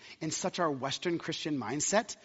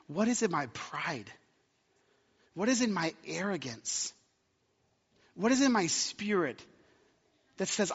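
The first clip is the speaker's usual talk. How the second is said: gentle guided reflection, eyes closed. Acted. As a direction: bring the room into stillness. Leads reflection, close your eyes.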